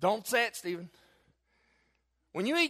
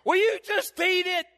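high, loud, slow imitation of an exasperated wife; mock dainty wife; 12 semitones higher, 8 dB louder than usual. Wife scolding husband over his cereal, mock shrill exasperation. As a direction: ham up the wife, far from dainty. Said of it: "very daintily".

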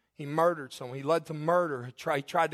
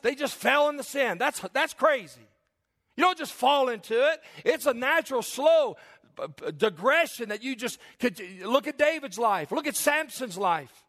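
higher-pitched, emphatic pulpit delivery; forceful preaching; 8 semitones higher, 3 dB louder than usual. Real, not acted. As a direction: preach it hard, hammering each phrase. Preacher drives his point home.

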